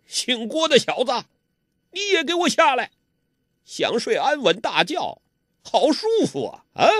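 high, fast sneering villain voice; mock the boy with a snort; scornful villain. A narrator's reading. Ouyang Feng sneering at Guo Jing to come down, high and scornful. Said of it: "欧阳锋哼了一声".

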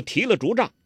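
ordinary delivery, nothing marked

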